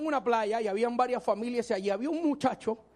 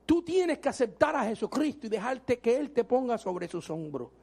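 normal talking voice

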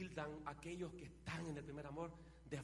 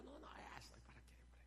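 mock muttered scoff; 7 semitones higher, 11 dB quieter, faster than usual. Mocking scoffer aside.

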